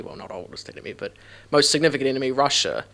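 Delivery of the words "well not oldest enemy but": quiet whispered aside; muttered correction. Real, not acted. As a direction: drop to a whisper to correct yourself.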